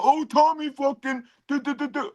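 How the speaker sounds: high, squealing mock fan voice; high mocking caricature; mocking imitation. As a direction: lampoon the fan in a squeaky pitch. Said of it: "And then you go".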